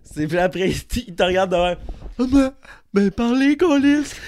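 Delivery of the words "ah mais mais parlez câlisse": high, loud mock exasperation; hammed-up angry buddy, high and loud; imitating Will's angry outburst. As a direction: play the annoyed friend blowing up, pitched high. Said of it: "faire son petit sketch de Mandalorian".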